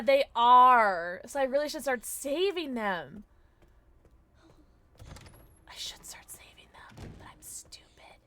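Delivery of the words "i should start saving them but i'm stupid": self-mocking whisper aside. Real, not acted. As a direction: whisper it to yourself. Unvoiced aside.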